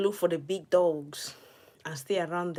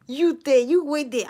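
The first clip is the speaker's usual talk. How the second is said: loud, higher warning call; mock threat; 8 semitones higher, 9 dB louder than usual. Gamer threatening a rival snake, loud playful warning.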